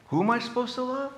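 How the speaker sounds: high, incredulous whining questions; mock confused believer. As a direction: act out someone baffled at who to love. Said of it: "See how complicated we make it?".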